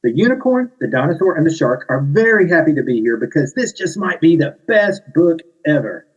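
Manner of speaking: high, bright read-aloud; storytime narration. Acted. Author reads aloud to kids, bright high storytime voice. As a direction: build up to best book ever. Reading picture book.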